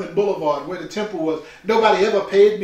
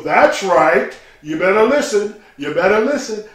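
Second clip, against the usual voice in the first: a louder, booming call from his father across the temple; father's voice; 7 dB louder than usual. Retold or imitated. Proud father calling out to the congregation, loud and firm.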